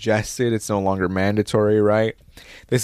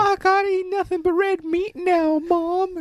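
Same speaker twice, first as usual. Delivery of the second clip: put-on much higher, squeaky kid voice; mock baby voice. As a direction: whine it like a toddler demanding meat. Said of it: "babies watching Joe Rogan going".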